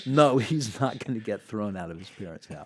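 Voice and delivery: a lightly put-on Tom, insisting firmly; imitating Tom. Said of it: "I think he's like".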